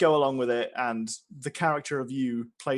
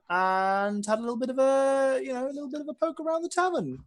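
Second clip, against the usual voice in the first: sing-song; 10 semitones higher than usual. Acted. Playful sing-song narration.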